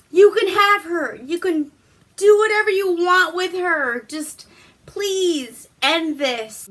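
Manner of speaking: desperate pleading. Retold or imitated. Nobleman begs Prospero to end the plague, high desperate pleading. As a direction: beg him to take anything, just stop it.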